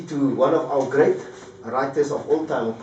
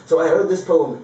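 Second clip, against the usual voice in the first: ordinary talk, nothing marked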